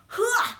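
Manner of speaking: short, loud, high "huh!"; imitated war cry; battle chant grunt. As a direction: punch out a warrior's shout. Mimics warriors' battle chant.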